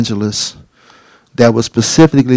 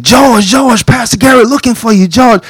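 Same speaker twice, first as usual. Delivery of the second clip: higher calling his name; 9 semitones higher than usual. Retold or imitated. Inmates calling George that the pastor wants him. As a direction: call across the yard, higher.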